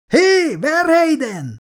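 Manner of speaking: slow high shout of a name; drawn-out high call. Acted. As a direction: snap him back to reality. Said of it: "Miller kiáltása".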